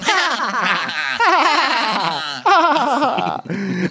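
hammed-up fake cackle; fake laughing. Erica and Sutton laughing at each other, mock forced laugh. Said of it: "Erica and Sutton are fake laughing".

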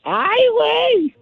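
high, slow drawn-out "Ay, güey"; put-on voice. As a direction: stretch it out in a silly high voice. Recurring put-on catchphrase voice.

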